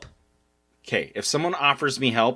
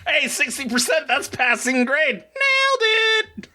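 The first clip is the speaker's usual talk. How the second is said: high, teasing victory cheer; mock triumph; 13 semitones higher than usual. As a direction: tease her with fake celebration. Mocking partner's low score.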